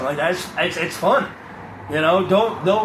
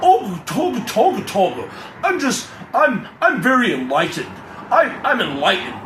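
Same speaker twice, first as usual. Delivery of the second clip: mock enlightened guru; 3 semitones higher than usual. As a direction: ham up a smug guru. Mocking self-proclaimed enlightened people.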